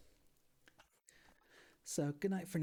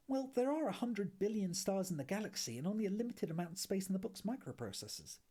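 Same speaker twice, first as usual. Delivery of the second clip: a hint of Ford, higher and breezy; lightly voiced Ford; 7 semitones higher than usual. Ford excusing the Guide to Arthur, lightly voiced higher. Ford's character voice.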